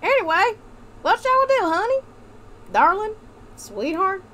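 put-on high, coaxing Southern-belle accent. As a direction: call your husband pet names in a hammed-up Southern accent. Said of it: "this is the accent we're gonna do".

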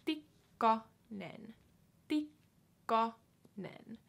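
Tikkanen stretched very slowly; Tikkanen, each syllable apart. Shown as a demonstration. Teacher modelling name slowly for learners. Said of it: "slow model".

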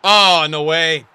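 loud, drawn-out cry of disbelief; stunned, stretched-out outburst; disbelieving shout. Real, not acted. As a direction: yell it slowly in shock. Reacting loudly to a near miss.

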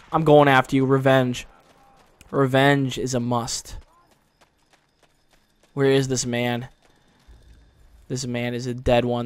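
ordinary delivery

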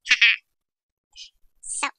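ordinary talk

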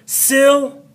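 Syl- held out high; stressed syllable. Shown as a demonstration. Teacher showing students the accent, Syl- pitched high. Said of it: "hear the difference in my voice?".

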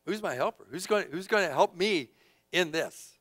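normal talking voice

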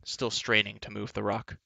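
ordinary delivery, nothing marked

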